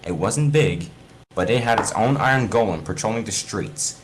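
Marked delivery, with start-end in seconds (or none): none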